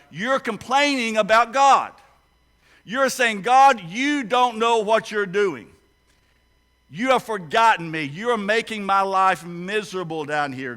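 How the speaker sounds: forceful sermon rebuke; loud rebuke. Real, not acted. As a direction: preach it hard at the listeners. Preacher rebukes complaining congregation.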